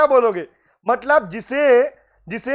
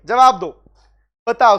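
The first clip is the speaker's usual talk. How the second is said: loud call for answers; urging the class; 6 dB louder than usual. Real, not acted. Teacher calling out to online students for answers, loud. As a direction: push the students to reply now.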